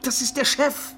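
can't believe the question; incredulous. Acted. Incredulous outburst about the boss. (fassungslos ungläubig)

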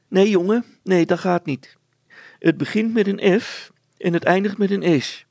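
high, loud woman's voice; fussy wife. Acted. Mother turns down Hannes's guess, high and loud. Wife corrects her son.

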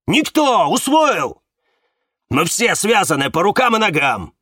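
high, loud, insistent outburst; angry bark. A narrator's reading. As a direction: drive it home at a near shout. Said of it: "рявкнул Магит". (рявкать громко и зло)

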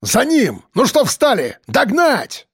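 high, urgent barked commands; barked order. A narrator's reading. Gang leader sends his men in pursuit, barked order. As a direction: rouse the men to give chase. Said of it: "рявкнул".

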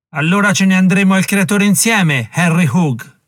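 grido fermo e forte; voce sicura. Acted. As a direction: risponde deciso, a gran voce. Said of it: "con voce sicura". (Herkimer risponde al nemico con voce sicura e forte)

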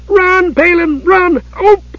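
very high-pitched warning cry; screech. A narrator's reading. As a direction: screech a frantic warning, then cut off. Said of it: "became a screech".

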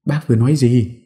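high, startled asking; high, startled what-did-you-say; surprised question. A narrator's reading. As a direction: ask in sudden surprise. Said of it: "Hải Thi ngạc nhiên".

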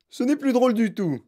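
high-pitched complaint; indignant protest. A narrator's reading. Father finds it no joke, indignant. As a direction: object with indignation.

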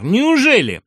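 high, loud incredulous cry; scandalised question; indignant disbelief. A narrator's reading. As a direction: exclaim in outraged surprise. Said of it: "возмутился гусар".

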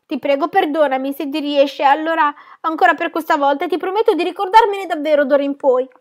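high, hurried-sounding plea for forgiveness; flustered, pleading apology; anxious pleading. Acted. Frightened child begs teacher's pardon.

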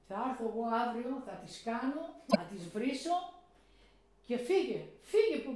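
a father ready to go curse them; indignant father. Retold or imitated. Father angry on her behalf. (ο πατέρας θυμωμένος για χάρη της, όλο και πιο δυνατά)

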